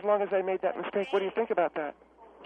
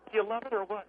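usual speaking voice

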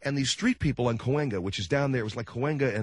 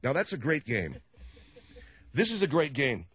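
ordinary delivery

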